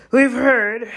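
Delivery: grumpy. A narrator's reading. Gamekeeper tired of centaur's stargazing answers, grumpy. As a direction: cut him off with a grumpy outburst. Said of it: "said Hagrid grumpily".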